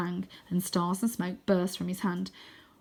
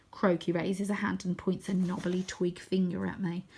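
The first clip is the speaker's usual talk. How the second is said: usual speaking voice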